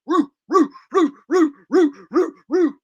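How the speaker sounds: high, loud "roo, roo" barking cheer; Arsenio Hall woof chant. Pretend. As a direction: whoop it like an old talk-show crowd. Arsenio Hall style crowd chant.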